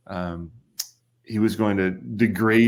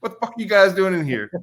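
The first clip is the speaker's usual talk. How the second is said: higher, louder, faster outburst; mock coach yell; 9 semitones higher, 6 dB louder, faster than usual. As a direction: burst in like the angry head coach. Head coach bursts into room.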